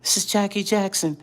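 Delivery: high, soft Michael-like voice; [name]. Retold or imitated. Caller introducing himself, high soft imitation. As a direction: lift it way up and keep it light. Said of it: "this is just how he sounded".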